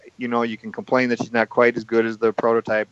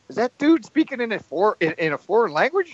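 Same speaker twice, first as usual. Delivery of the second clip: raised-pitch incredulous question; higher, puzzled quoted voice; quoting the furnace guy; 8 semitones higher than usual. Retold or imitated. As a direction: imitate a baffled listener asking in disbelief. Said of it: "he said to me".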